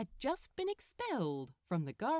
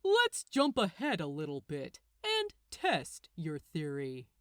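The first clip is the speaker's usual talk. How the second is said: challenging Gabriel; 7 dB louder than usual. Acted. Gabriel challenges Crowley to test his theory, scornful. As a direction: dare him to test it.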